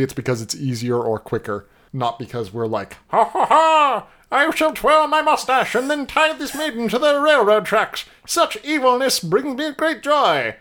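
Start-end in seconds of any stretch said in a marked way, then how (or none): 3.1-10.6 high, hammy cartoon villain voice; mustache twirling villain. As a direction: cackle and gloat like a melodrama villain. Mocking cartoon villain talk.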